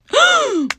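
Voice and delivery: loud, high shocked gasp; sharp, exaggerated intake of horror; dramatic gasp. Reacts to threat to call Eric.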